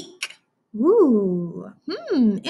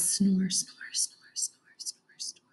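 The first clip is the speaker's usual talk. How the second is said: very soft, voiceless snores; bear snoring; 3 semitones lower, 10 dB quieter, slower than usual. Shown as a demonstration. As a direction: breathe the word like a snore. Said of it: "Bears snore".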